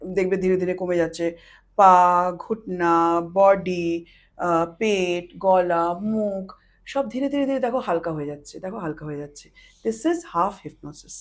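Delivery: low, slow hypnotist voice; hypnotic induction. Shown as a demonstration. Demonstrates trance induction to listener. (ধীর, নিচু সম্মোহনের সুর)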